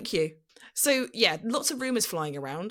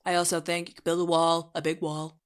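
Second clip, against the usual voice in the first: monotone synthetic presidential impression; deadpan robotic boasting; Trump. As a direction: parody politician in an even, AI-like delivery. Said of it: "sounds like a Trump A I voice".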